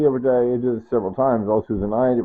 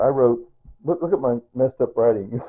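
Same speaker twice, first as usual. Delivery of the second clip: ordinary talk, nothing marked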